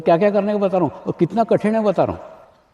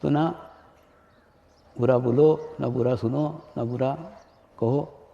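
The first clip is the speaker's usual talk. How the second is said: soft preaching; 6 semitones lower, 5 dB quieter than usual. Acted. Guru quietly teaching disciples about the mind's power, hushed and solemn. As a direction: settle into a hushed, grave lesson.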